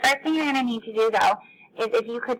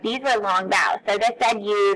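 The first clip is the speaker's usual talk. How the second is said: ordinary talk, nothing marked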